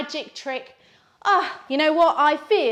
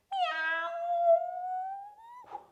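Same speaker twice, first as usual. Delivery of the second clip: high, long rising meow; long cat meow; 5 dB quieter than usual. Acted. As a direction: stretch it out like a lazy cat. Cat wants stroking.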